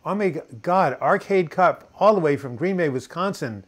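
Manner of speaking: high, loud delighted recognition; excited greeting. Real, not acted. Streamer spots an early supporter from Green Bay, excited.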